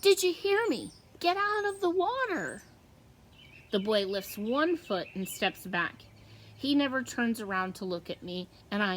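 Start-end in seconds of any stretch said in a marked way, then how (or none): 0.0-2.6 high, louder warning shout; urgent yell. A narrator's reading. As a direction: order him out of the water. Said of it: "I yell to the boy".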